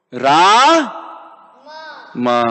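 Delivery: Ra held long, then Ma after a pause; syllable by syllable. Shown as a demonstration. Krishna reduces it to two syllables, slowly separated. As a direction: separate Ra and Ma, stretching each.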